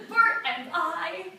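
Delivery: very loud, high, slow proclamation; drawn-out, high exclamation; rapturous boast. Acted. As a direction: belt it out, savoring each word. Revels in her dream about Burt.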